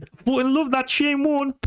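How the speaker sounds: a punchline repeat of the Geordie fan; exaggerated Geordie impression; Geordie accent. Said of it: "I was like".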